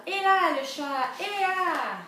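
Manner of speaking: high, loud call; calling the cat. Acted. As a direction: holler for the cat.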